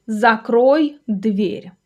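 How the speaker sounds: very slow, separated words; slow model sentence. Shown as a demonstration. Teacher giving a door example to learners, very slowly. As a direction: spell it out word by word for learners.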